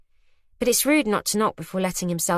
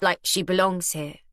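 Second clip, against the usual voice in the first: ordinary delivery, nothing marked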